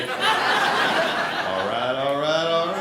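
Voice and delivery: low, lazy Texas drawl stretching each all right; Matthew McConaughey impression. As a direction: purr it out like a laid-back Texan charmer. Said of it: "mosquitoes sound like Matthew McConaughey".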